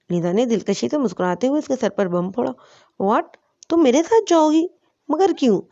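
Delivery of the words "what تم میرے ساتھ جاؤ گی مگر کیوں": حیران اور الجھا ہوا سوال; confused surprise. A narrator's reading. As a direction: react as if caught completely off guard. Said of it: "الجھتے ہوئے".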